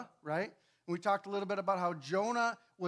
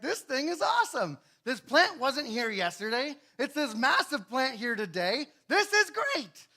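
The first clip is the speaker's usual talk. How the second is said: higher and louder, thrilled with the shade; excited Jonah; 5 semitones higher, 6 dB louder than usual. Acted. Jonah delighting in the plant that shaded him, excited. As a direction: gush over the surprise plant.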